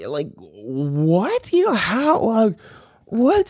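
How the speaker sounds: high, halting, lost for words; acted bewilderment. Speechless at girlfriend's threat, high bewildered sputtering. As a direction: sputter in high-pitched disbelief. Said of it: "bewilder me".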